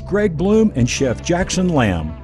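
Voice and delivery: ordinary delivery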